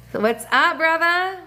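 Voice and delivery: excited greeting. Real, not acted. Streamer greeting a viewer.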